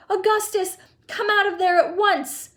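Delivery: high-pitched frantic order; yelled. A narrator's reading. Mother ordering stuck son out, high yell. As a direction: scold at a shriek. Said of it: "yelled Missus Gloop".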